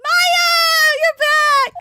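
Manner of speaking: high, loud happy call; excited shout. Acted. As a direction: call out gladly to a returning friend. Maya greets Nick excitedly.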